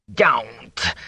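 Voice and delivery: raspy, urgent warning; rasped. A narrator's reading. Fane stops Rand from speaking, rasping. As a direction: rasp it out as one sharp word. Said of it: "he rasped".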